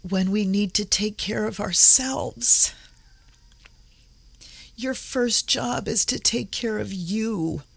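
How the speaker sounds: earnest plea, collecting yourself through emotion; choked up. Real, not acted. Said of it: "Why am I getting choked up?".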